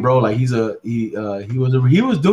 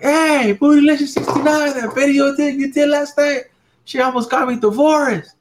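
much higher, louder mock complaint; hammed-up high man's voice; high-pitched voice of the husband; 4 dB louder than usual. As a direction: call her out loudly in a squeaky put-on voice. Joke: voicing the friend's husband.

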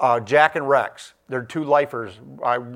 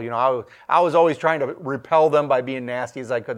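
ordinary talk, nothing marked

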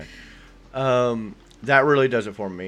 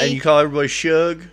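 louder, higher country Southern voice; country Southern accent; 5 semitones higher, 7 dB louder, faster than usual. Acted. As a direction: put on a country Southern twang. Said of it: "that country Southern".

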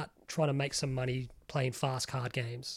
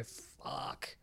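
hushed dismay; 3 semitones lower, 9 dB quieter, much slower than usual. Retold or imitated. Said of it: "for you to go".

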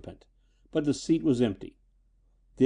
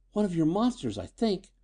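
put-on higher-pitched, mildly rattled girl; shaky; 6 semitones higher than usual. A narrator's reading. Daughter tells her father of the attack, shaky. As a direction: answer with a touch of unsteadiness. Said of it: "she replied shakily".

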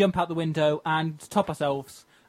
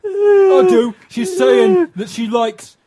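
cockney geezer; 8 semitones higher, 9 dB louder, slower than usual. Acted. Improvised character reply.

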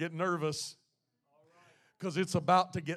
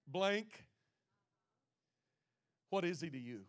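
normal talking voice

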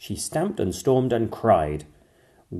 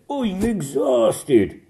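high, louder, very slow dragon voice; exhausted dragon; 12 semitones higher, 6 dB louder, much slower than usual. A narrator's reading. Zog complaining while flying, exhausted. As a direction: drag it out, worn out. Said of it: "Zog said".

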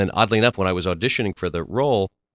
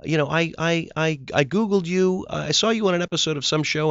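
excited fan; 10 semitones higher than usual. Retold or imitated. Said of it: "people come up to me in airports and say".

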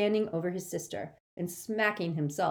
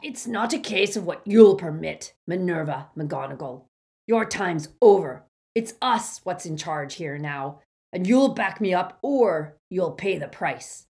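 threatening; 8 dB louder than usual. A narrator's reading. Amycus threatening.

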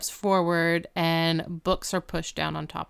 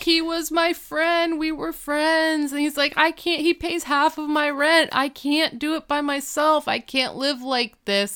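mock wailing; 8 semitones higher than usual. Grieving friend wails at window.

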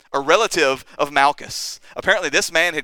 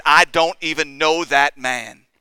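slow, emphatic swearing-off; sworn denial; 4 dB louder, slower than usual. Retold or imitated. Peter swears to the crowd, emphatic denial. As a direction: lean on each word of the denial. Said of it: "And he swears".

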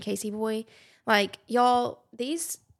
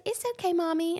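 higher, imitating a little girl; little daughter; 9 semitones higher, faster than usual. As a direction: do a toddler's voice answering her mom. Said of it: "And she'll say".

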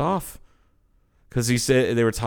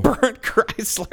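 laughing nickname; 13 semitones higher, slower than usual. Real, not acted.

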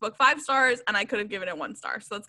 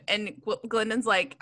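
ordinary delivery

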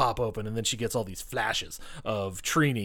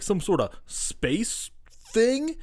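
puzzled Rocky; 8 semitones higher, much slower than usual. Retold or imitated. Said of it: "He's like".

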